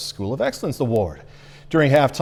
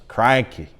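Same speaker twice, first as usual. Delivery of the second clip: "crikey." in a lightly faked Australian accent, falling tune; a last modest stab at an Aussie "crikey."; Australian accent try; 3 semitones lower than usual. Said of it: "It's as best as it will get".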